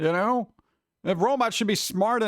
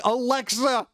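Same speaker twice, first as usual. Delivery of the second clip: high-pitched, hammed-up call after someone leaving; mock pleading; 8 semitones higher than usual. User to Alexa virtually walking out of the room, mock pleading. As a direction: play the jilted owner, voice pitched up. Acting plea to departing Alexa.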